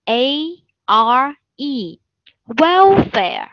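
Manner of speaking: each letter of welfare spaced out very slowly; slow spelling dictation. Shown as a demonstration. Teacher spelling welfare out very slowly for the class. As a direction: spell it letter by letter so students can write along.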